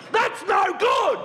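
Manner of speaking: very high, drawn-out outraged yell; hammed-up indignant wail; indignant cry to the heavens. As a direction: protest slowly to God at the top of your range. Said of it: "he reiterates to the ether or God".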